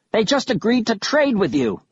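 high, indignant mild bellow. A narrator's reading. Kaz confronts Killiman over the trap, bellowing. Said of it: "I heard Kaz bellow at Killiman".

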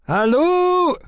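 shrill, loud halloo; piercing high-pitched hail. A narrator's reading. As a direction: call shrilly from above. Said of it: "came a shrill voice from above".

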